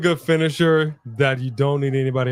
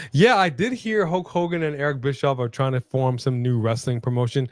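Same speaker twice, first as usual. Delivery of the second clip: ordinary talk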